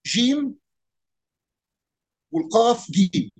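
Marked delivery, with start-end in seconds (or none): 0.0-0.5 جيم with a hard g. Shown as a demonstration. Teacher shows how Upper Egypt says جيم. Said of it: "بينطق الجيم".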